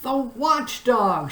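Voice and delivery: high, slow, alarmed cry; fearful shout. A narrator's reading. Lothargian warns the others, high and frightened. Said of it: "shouted another".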